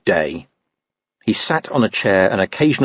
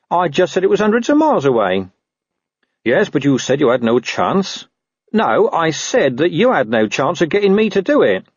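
higher-pitched, insistent character voices; quibbling in a raised doggy voice; argumentative talking dog; 8 semitones higher than usual. Acted. Dog and watchman bickering over words, higher and insistent.

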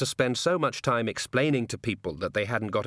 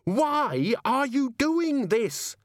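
high, drawn-out exasperated scream; much slower than usual. Acted. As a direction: scream the question, stretching every word. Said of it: "Susan screamed".

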